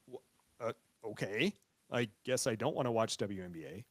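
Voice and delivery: low, quiet resignation; deflated giving in. Acted. Host gives in to the group chat, acting deflated. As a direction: back down meekly, dropped in pitch and volume.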